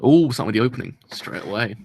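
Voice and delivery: ordinary talk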